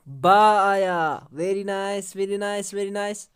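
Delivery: usual speaking voice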